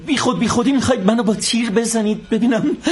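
usual speaking voice

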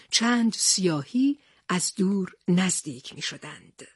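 plain reading voice

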